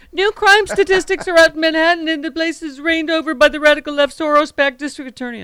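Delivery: high, loud mock Trump all-caps post; mocking Trump rant. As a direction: ham up an outraged Trump screed, pitched well up. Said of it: "announcement from the bassinet".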